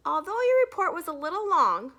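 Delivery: lightly voiced teacher with a pointed tone. A narrator's reading. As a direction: stress the criticism with a smile.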